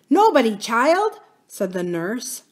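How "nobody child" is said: high, loud, impatient dismissal; almost angry. A narrator's reading. Nurse to crying princess, almost angry reply. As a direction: cut the child off, nearly cross. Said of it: "almost angrily".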